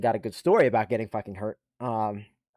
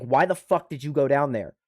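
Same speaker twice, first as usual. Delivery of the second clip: ordinary talk, nothing marked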